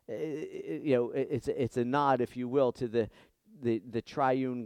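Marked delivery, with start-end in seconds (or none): none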